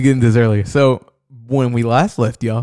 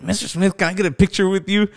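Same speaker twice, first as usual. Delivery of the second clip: lightly put-on higher voice; imitated voice effect; 6 semitones higher than usual. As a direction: mimic a player's altered voice, asking a favour. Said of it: "this effect on his voice".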